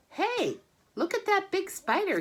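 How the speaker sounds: put-on high excited boy calling; a kid spotting a big spider; high, eager boyish shout. A narrator's reading. Boy Avery calls out.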